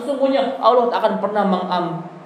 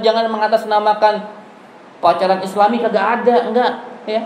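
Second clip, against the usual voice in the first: normal talking voice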